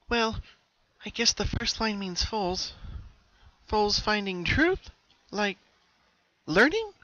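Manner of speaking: high, bright pony voice; Pinky voice. Acted. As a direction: lift into a young mare's puzzled voice. Pinky voiced in read story.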